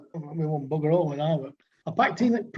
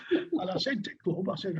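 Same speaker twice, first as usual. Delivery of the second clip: ordinary delivery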